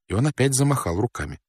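usual speaking voice

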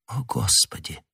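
soft, helpless murmur; hushed, faltering exclamation; faint babble. A narrator's reading. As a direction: murmur it very softly, barely getting it out. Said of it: "пролепетала Кэтти".

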